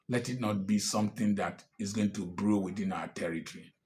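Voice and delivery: ordinary talk, nothing marked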